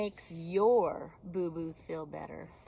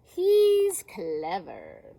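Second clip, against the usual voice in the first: slow loud emphatic; 10 dB louder, much slower than usual. Acted. Sheep praises Fox.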